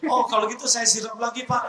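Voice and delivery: high, fast, cheeky offer with a laugh; a joking motorcyclist, eager to help; cheeky biker. Acted. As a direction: chirp it back as a cheeky biker. Biker joke reply, high and fast.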